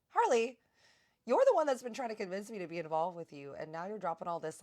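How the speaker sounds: high, loud disbelieving cry; wait, what? exasperated shock; incredulous outburst. Retold or imitated. Host reacting to Harley's sudden bad-man reveal, loud disbelief.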